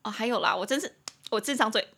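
ordinary talk

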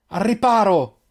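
high, urgent shout; shouted warning. A narrator's reading. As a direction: yell for everyone to take cover. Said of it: "urlò Eirin". (urlo di allarme)

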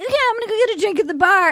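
rattled off very fast; quick breezy. Retold or imitated. Recapping Jasenia heading for the bar, rushed and breezy. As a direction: rush through it like you're already leaving.

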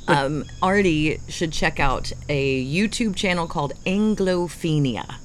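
normal talking voice

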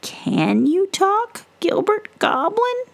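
high, slow gentle question; witch voice. Acted. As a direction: ask it tenderly. Witch asks.

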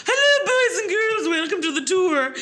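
very high, bright, laughing elf greeting; perky elf voice. Retold or imitated. Brona forcing a cheery elf welcome to children. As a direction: chirp it out like a cheery elf after throwing up. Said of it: "as her, I think her name was Bobby the Elf".

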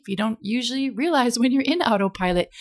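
ordinary delivery

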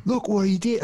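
imitating an Australian, unconvincingly; bad Australian accent. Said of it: "in Australian accents, be like".

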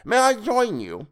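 pinched, reedy nasal creep; high nasal voice. Acted. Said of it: "Toadface said in a high nasal voice".

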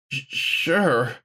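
breathy, stumbling over the word; breathless stammer. A narrator's reading. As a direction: barely get the word out, breathy. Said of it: "Gawain managed to breathe".

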